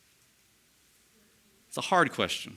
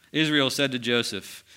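ordinary talk

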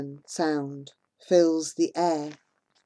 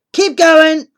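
much louder, higher command; barked order; 8 semitones higher, 11 dB louder than usual. Acted. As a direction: hurry the queue along. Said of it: "cries one of the men".

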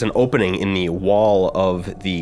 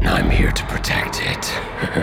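deep whispered monster voice; slower than usual. Acted. GM voices worm reply.

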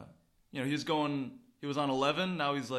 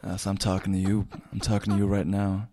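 lower-voiced joking tough guy calling a listener big boy; mock macho voice; 6 semitones lower, 5 dB louder than usual. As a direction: tease the pilot like a swaggering bro.

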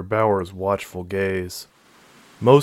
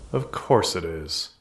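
eye-rolling; 3 semitones lower, 5 dB quieter, slower than usual. A narrator's reading. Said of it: "Marie thought with a roll of her eyes".

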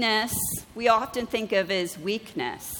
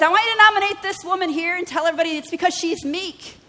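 higher, faster, lightly set-apart divine voice; voice of the Lord; 8 semitones higher, 4 dB louder, faster than usual. Retold or imitated. Preacher voicing the Lord telling her to nominate the woman, higher and quicker. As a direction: deliver God's instruction, brisk and bright.

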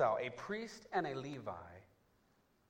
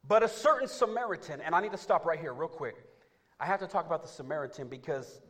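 lively preaching; 5 semitones higher, 11 dB louder than usual. Acted. Preaching at full force.

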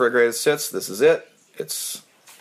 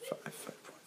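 whispering; 25 dB quieter than usual. Real, not acted. Counting points under breath.

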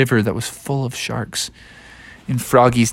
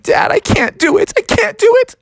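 very high, acted frightened whine; panicky plea; 4 dB louder than usual. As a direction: squeal it to Dad in rising panic.

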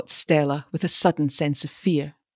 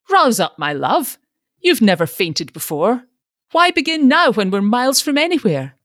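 higher, louder fussing father; fussy father; 6 semitones higher, 5 dB louder than usual. A narrator's reading. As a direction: scold her awake, fretful. Father fussing over fainting daughter.

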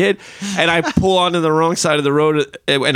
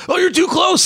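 mock panicked parent. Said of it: "and they're like".

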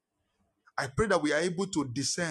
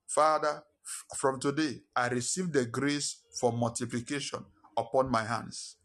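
murmured prayer; 5 semitones lower than usual. Acted. Leads congregation in prayer.

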